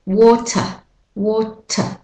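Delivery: British Australian, schwa ending on "water"; British Australian pronunciation. Shown as a demonstration. Teacher models water for learners, British Australian accent. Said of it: "say the British Australian way".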